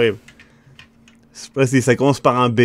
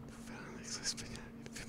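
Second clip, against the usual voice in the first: whisper; 29 dB quieter than usual. Real, not acted. Hint whispered to co-player.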